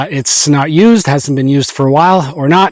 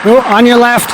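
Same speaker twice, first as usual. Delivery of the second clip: raised, higher voice calling a warning; passing cyclist calling out; 7 semitones higher than usual. Real, not acted. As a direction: call out to walkers ahead. Cyclist warning hikers while passing.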